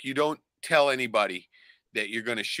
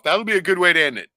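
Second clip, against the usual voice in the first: louder, pumped-up sign-off; big announcing voice; 5 semitones higher, 7 dB louder than usual. Shown as a demonstration. To his co-host, announcing the closing clip with hype. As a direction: hype the ending up to the host's friend. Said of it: "bring it down".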